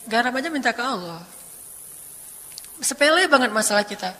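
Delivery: usual speaking voice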